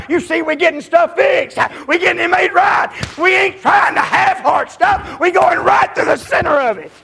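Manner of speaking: loving preacher bellowing reassurance to a brother; full-force preaching. Acted. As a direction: proclaim it with all you've got.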